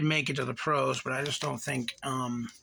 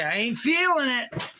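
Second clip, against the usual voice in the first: higher, louder, emphatic; 9 semitones higher, 3 dB louder than usual. Real, not acted. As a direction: sing it out like a catchphrase. Catchphrase repeated, then fading.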